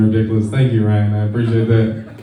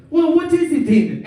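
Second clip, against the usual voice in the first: high, louder mock insistence; Hector pushing; 11 semitones higher, 4 dB louder than usual. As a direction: make Hector press for an answer. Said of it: "He said".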